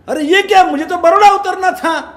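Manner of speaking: high, loud cry of alarm at the wrong stop; as if waking to find the plan ruined; shocked realization. Acted. Man woken at Surat realizes he missed Baroda, alarmed.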